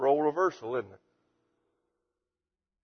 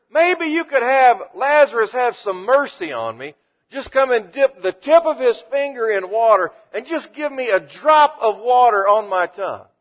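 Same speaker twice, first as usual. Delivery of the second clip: begging rich man; 9 semitones higher, 7 dB louder than usual. Acted. Rich man begs for water.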